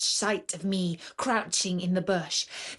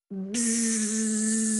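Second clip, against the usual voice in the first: mosquito buzz. Acted. Mosquito buzzing near the ear.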